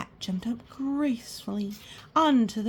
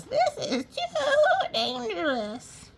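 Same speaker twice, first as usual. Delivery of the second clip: high slow quavering sheep voice; slow high frightened sheep; fearful sheep; slower than usual. Acted. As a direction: bleat it out, scared. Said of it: "what she thought was".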